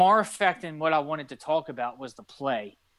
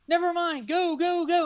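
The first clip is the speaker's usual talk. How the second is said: very high excited cheering shout; yelling at the TV; 13 semitones higher than usual. Retold or imitated. Fan cheering the receiver through the TV, high excited yell. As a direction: urge the receiver on. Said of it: "yelling at the T V".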